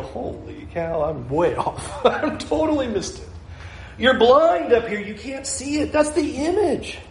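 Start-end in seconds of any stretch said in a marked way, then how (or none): 0.0-3.3 amused self-mocking surprise; laughing dismay. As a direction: laugh at your own crooked frame. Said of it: "back up twenty feet and you go".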